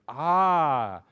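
loud, drawn-out mock-knowing "Ah"; teasing aha; playful teasing. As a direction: exclaim as if catching them out. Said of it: "decided to have a little fun with her".

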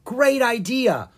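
high, slow, eager agreement; enthusiastic. Acted. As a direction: cheer the idea on. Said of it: "Owen agreed".

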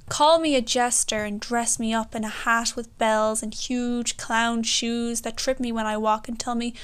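ordinary talk

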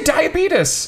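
"diabetes" as dye-uh-BEE-tus, high and insistent; mispronounced diabetes. Retold or imitated. Cohost insists to doubting friend, "diabetes" as dye-uh-BEE-tus. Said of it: "Southern people pronouncing it wrong".

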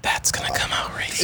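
soft unvoiced whisper. Real, not acted. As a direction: whisper it conspiratorially. Hushed echo of the worry.

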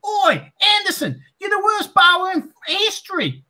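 high mock heckler shout; heckling fan. Fan taunting Anderson as he walks out, high shouted jeer. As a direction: bellow it across the ground.

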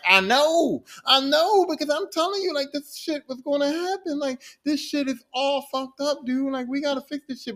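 urgent and panicked, I told you so; frantic Loki. Acted. Loki to the TVA, high frantic I-told-you-so.

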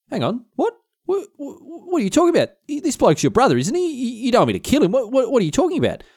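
high, stammering mock bafflement; baffled disbelief. William hearing Agnes wants her brother killed, baffled disbelief. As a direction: splutter the questions in comic disbelief.

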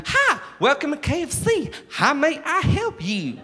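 exaggerated American fast-food hospitality; American KFC server. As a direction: sing out a Kentucky-style American welcome. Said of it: "expecting it to be like Kentucky Fried Chicken".

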